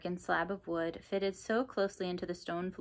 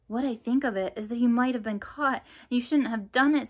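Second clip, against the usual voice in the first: raised, scolding voice; 6 semitones higher, 8 dB louder than usual. Acted. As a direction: tell him off sharply. Emily scolds Kipper.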